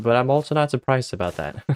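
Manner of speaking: ordinary talk, nothing marked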